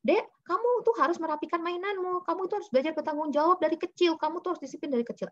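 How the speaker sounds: high-pitched nagging lecture; parent lecturing child. Shown as a demonstration. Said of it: "Kita tidak perlu mengatakan kepada anak". (orang tua menceramahi anak dengan nada tinggi)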